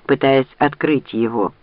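ordinary delivery

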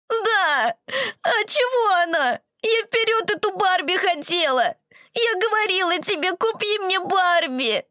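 high, wailing tearful complaint; crying child yelling. Acted. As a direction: howl it through tears. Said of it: "выкрикивать сквозь рёв".

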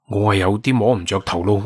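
ordinary delivery

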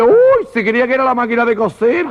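high-pitched comic astonishment; camp surprise. Acted. Friend's camp surprised reply.

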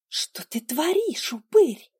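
hissed, sharp breathy whisper; breathy hiss through teeth. Acted. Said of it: "прошипела я в ответ".

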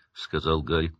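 usual speaking voice

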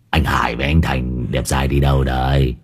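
mildly gruff pomelo seller; lightly voiced girl. Village girl selling pomelos.

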